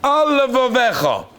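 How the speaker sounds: Al Levavecha slurred slow, lamed swallowed into one word; words run together. Shown as a demonstration. Rabbi showing his class a sloppy Al Levavecha, slow and slurred. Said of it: "you missed a lamed there".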